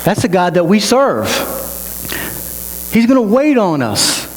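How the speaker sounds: high-pitched, emphatic declarations; impassioned preaching. Real, not acted. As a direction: drive each promise home to the congregation.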